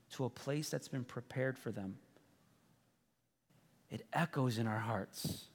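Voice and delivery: soft, low and solemn, long pauses; hushed, heartfelt sermon moment; tender preaching. Real, not acted.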